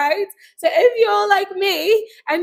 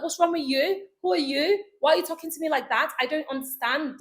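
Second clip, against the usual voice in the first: mock-indignant British accent; angry British accent; 4 dB quieter than usual. Shown as a demonstration. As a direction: get pissed off in British English. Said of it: "my accent is British".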